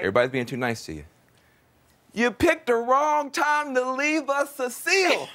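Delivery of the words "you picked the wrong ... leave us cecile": hammed-up shout of protest; mock outrage. Host playfully scolds departing guest.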